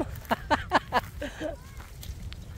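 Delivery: long, high-pitched giggling laugh; real laugh. Real, not acted. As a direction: burst into a run of high laughter. Laughs after remarking on buffalo. (tràng cười giòn, cao giọng)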